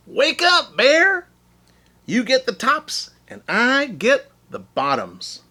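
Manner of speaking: high, loud wake-up call. Acted. Hare calling out to wake Bear at harvest, loud and high. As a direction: shout to rouse a sleeper. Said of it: "Hare called out".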